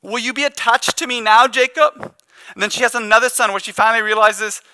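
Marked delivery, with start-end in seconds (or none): 0.0-2.1 higher plea, rising on Jacob; pleading cry. Retold or imitated. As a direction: beg the husband to finally look at her. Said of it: "Almost like she's crying out and saying".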